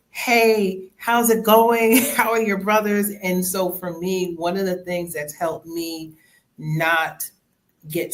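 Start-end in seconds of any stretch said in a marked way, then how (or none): 0.1-3.1 lightly voiced aunt, higher and louder; a cheerful aunt asking, a touch higher. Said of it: "And then maybe a minute later".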